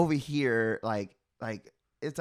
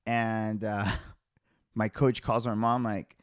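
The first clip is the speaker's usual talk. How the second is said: ordinary delivery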